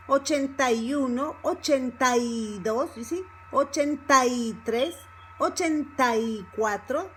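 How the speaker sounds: "ochenta y" run together, stress on "ta-y". Shown as a demonstration. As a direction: join "ochenta y" as one word before each number. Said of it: "start speaking a little bit faster".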